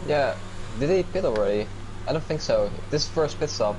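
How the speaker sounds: ordinary talk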